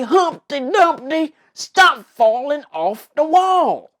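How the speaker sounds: high, loud country accent. Shown as a demonstration. Host repeating the funny voicing for children, country voice. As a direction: holler it in a put-on country accent. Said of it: "voicing that country accent".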